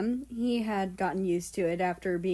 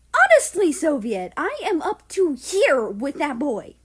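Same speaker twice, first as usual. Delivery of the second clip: loud exasperated scolding, high and sharp; fed-up parent telling off; angry scolding; 6 semitones higher, 7 dB louder than usual. Acted. Nazi scolds his husband about the stepson, exasperated loud rant.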